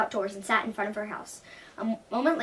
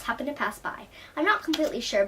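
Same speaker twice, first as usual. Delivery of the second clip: usual speaking voice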